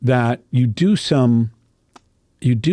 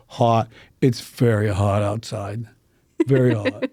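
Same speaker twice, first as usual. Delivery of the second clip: "Hot" said hawt, Pittsburgh rounded vowel; mock Pittsburgh local, "very hot" with an aw; Pittsburgh accent; 2 semitones lower than usual. Shown as a demonstration. Coach demonstrating Pittsburgh dialect on the word hot. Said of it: "When we go to the Pittsburgh dialect, we go".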